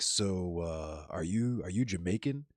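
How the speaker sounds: low, soft, halting question; hesitant stranger. Retold or imitated. Man approaching a stranger at a bar, imitated as hesitant and quiet. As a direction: mimic a man sheepishly feeling his way into a question.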